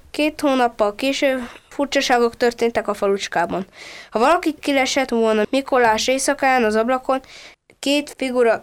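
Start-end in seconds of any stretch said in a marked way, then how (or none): none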